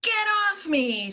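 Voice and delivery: high, irritated shove-off; high cranky crayon protest; annoyed crayon. Acted. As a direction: push him off, annoyed. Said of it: "says the orange crayon".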